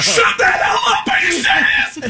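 star-struck fan shrieks at the quarterback; ecstatic disbelief. Retold or imitated. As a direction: squeal it out in shocked delight. Fanboy reacting to star recognizing him.

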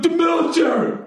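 high, whiny put-on heckler voice; mock heckler. Comedian mimicking heckler's answer, high whiny voice. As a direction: sneer it like the self-righteous heckler. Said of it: "He's like".

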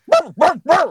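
high, punchy mock barks; three quick high woofs, played for laughs; imitated pig bark. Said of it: "cuts it open and goes".

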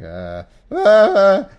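hammed-up high, loud mock sob; badly faked whimper of grief; fake crying. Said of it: "if he's crying, you have to be like".

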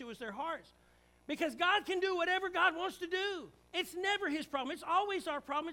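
rising, fiery pulpit delivery; full-force preaching. Acted. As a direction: preach it hard to the congregation.

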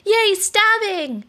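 put-on high, slow gleeful child cheer. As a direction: cheer like a creepy kid.

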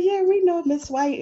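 high, loud mock of students answering; sing-song reply from the class; students teasing. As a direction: kids drawling back at the teacher. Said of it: "They said".

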